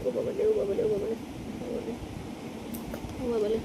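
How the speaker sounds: low, hushed repeated murmur; soft murmuring. Real, not acted. Parent to baby being fed, soft low murmuring.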